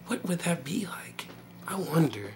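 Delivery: soft, slow, whispered wondering; acted dreamy murmur, drawn out; musing to himself. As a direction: hush it into a private thought. Acts out thinking to himself.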